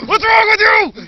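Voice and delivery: very high, loud, slow shout. Retold or imitated. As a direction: yell it at the man. Shouting at the screaming guy.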